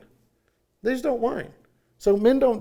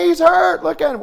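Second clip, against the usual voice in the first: high, loud alarmed cry; the party dad's wife shrieking in mock alarm; panicked yell; 12 semitones higher, 10 dB louder than usual. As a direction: cry out like a frantic mom. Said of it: "she comes running in there".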